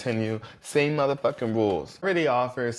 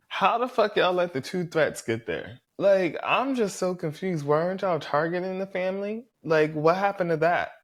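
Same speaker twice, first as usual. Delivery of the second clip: higher, incredulous rant; ranting at the cast for letting threats through, higher; exasperated disbelief; 7 semitones higher than usual. Real, not acted. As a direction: throw up your hands at the players.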